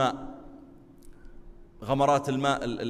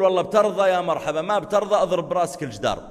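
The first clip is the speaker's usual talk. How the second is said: dismissive quip; 4 semitones higher, 7 dB louder than usual. Retold or imitated. Mocking careless man.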